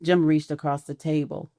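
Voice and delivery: usual speaking voice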